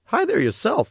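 cartoon otter greeting a new arrival, all sugary cheer; carnival otter voice. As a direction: gush like a cute carnival mascot.

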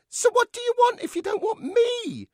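very high, loud puppet outburst; indignant dummy. Acted. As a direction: demand an answer at a high pitch, loudly.

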